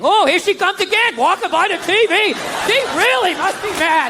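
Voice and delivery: high, loud play-by-play on the wife; mock TV commentator. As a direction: narrate her like a sports announcer. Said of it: "I just start commentating".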